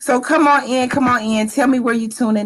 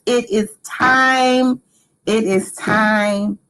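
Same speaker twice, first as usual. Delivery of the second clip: slow dramatic announcement; 2 semitones higher, much slower than usual. Acted.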